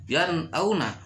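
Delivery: "yan auna" with a short clear n; clear idhar reading. Shown as a demonstration. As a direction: cut the n of "yan" before "auna". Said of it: "itu dibaca udah jelas idhar".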